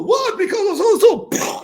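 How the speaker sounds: very high, loud incredulous echo; mock him in disbelief; high incredulous parroting; mocking outrage. Franz mocking the bailiff.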